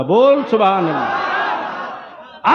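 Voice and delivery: slow, laughing shout of सुभान अल्लाह; loud call. Shown as a demonstration. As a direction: call out boldly, no fear. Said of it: "अरे बोल, ललकार के".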